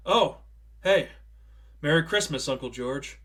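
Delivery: jumpy, bright hello; startled greeting. A narrator's reading. Lucille startled by her uncle at the door, bright greeting. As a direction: caught off guard, then cheerful. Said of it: "Lucille jumped a little in her seat".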